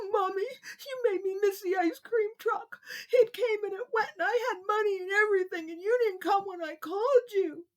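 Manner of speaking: high, tearful complaint; crying little boy. Acted. Kevin crying at Mommy for missing the truck, high tearful voice. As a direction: wail like a kid who missed out.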